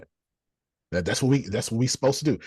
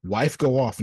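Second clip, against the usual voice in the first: normal talking voice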